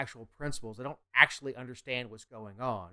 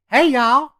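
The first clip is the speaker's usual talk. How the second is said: loud, high-pitched Southern hostess holler; Paula Deen; 11 semitones higher, 17 dB louder than usual. Acted. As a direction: holler it high like a TV cook's greeting. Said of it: "like Paula Dean's, uh, voice acting".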